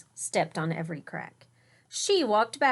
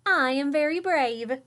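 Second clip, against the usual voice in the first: proud boast; 5 semitones higher, 5 dB louder than usual. Acted. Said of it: "patting herself on the back".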